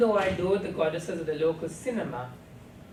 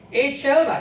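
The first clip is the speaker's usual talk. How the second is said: loud, high jeering hail across the room; mocking call-out; 9 semitones higher, 11 dB louder than usual. A narrator's reading. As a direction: call out to draw everyone's attention. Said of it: "Cyril uncle cried out".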